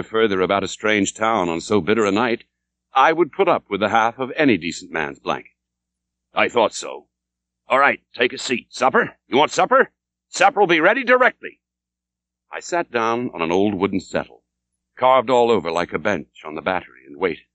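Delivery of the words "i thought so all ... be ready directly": a lightly voiced old landlord, sly and chummy. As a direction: play the innkeeper, teasing and higher.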